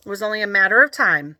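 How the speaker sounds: plain reading voice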